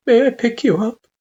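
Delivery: very high, careful mother-figure voice; gentle parent. A narrator's reading. Parent asks child before lifting them, gently. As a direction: ask tenderly, pitched high.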